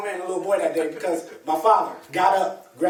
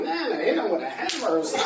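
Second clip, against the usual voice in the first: laughing while quoting; 3 semitones higher, 5 dB quieter than usual. Retold or imitated. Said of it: "I'm like".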